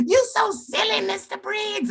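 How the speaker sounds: put-on very high kid voice, amused; imitated pupil. Pupil to his teacher after laughing, high imitated child voice. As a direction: squeak it out like a tickled child.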